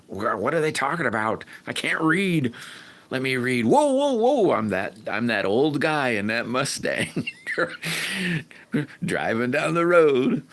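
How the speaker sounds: high put-on voice of a confused old guy driving; imitating a driving friend. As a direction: act out Rick squinting at the screen while driving. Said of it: "he's going".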